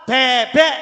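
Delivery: "bebek" loud, with a heavy open E in both syllables. Shown as a demonstration. Teacher giving an example of the heavy E, emphatic. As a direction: punch out "bebek" with stretched open E. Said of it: "berarti tambah berat. E".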